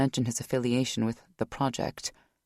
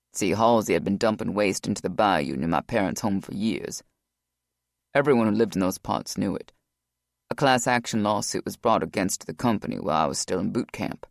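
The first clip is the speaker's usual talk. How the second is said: lower, steady male voice; Rock's voice; 6 semitones lower than usual. A narrator's reading. Rock explains.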